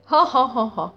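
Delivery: hammed-up ha ha ha laugh; a put-on belly laugh at the joke; mock laugh. Krato laughing at the jab about his face, hammed-up laugh.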